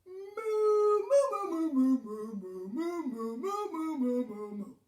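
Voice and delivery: loud run of rolling moos, starting high; cow mooing. A narrator's reading. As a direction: moo on and on as if explaining. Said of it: "He said".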